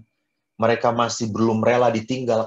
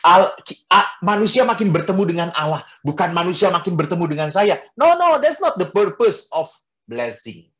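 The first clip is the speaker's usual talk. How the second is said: emphatic; 5 semitones higher, 6 dB louder than usual. Real, not acted. Emphatic preaching climax.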